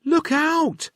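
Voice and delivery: high, urgent cry; yelled warning. Acted. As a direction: warn them of the crash. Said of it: "yelled Chip".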